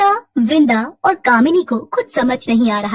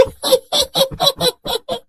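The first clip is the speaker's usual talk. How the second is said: high-pitched crazed giggle; mad cackle; 11 semitones higher than usual. Acted. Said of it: "खिलखिलाकर हंसने लगी". (पागलों जैसी ऊँची खिलखिलाहट)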